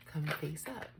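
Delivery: ordinary talk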